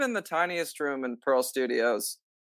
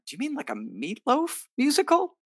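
much higher, put-on incredulous question; mock disbelief; 12 semitones higher than usual. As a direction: play it as a hammed-up double take. Retold disbelief at a stranger.

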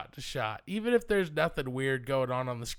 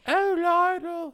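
very high, loud, drawn-out screech; overbearing mother; 8 dB louder, slower than usual. Acted. Insufferable mother calling her son Lionel, shrieking falsetto. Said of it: "like, um, Norman Bates's mother".

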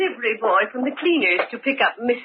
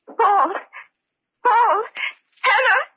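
high, loud cries for help in the dark; frightened calls; 3 dB louder than usual. Acted. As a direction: call out, scared, for anyone. Alone in dark, calling for help.

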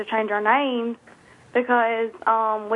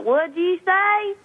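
put-on drawn-out Cajun New Orleans accent, raised pitch; slow, higher Cajun drawl, teasing; mock Cajun local; 7 semitones higher, slower than usual. Mimicking New Orleans locals who can't understand her, mock Cajun drawl. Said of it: "they're like".

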